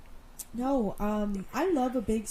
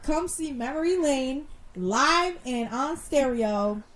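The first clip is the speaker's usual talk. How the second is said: slow, raised show plug; promo announcement; 4 semitones higher, slower than usual. Acted. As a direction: announce the gig like an ad. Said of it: "we're gonna do it again".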